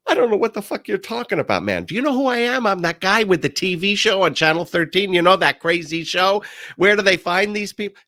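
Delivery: high, loud and fast, talking his way out; high rushed shout, bluffing a robber; frantic bluffing shout. Retold or imitated. Retold panicked bluff at gunpoint.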